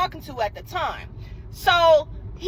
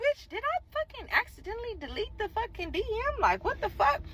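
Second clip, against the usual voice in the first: much softer, high, frantic hush; softer panicked self-scolding; 7 semitones higher, 8 dB quieter than usual. Retold or imitated. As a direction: gasp it under your breath in horror. Fears she deleted the DM.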